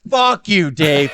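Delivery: high, loud angry retort; upset outburst. Real, not acted. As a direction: shout it back, worked up. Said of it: "you're getting really upset".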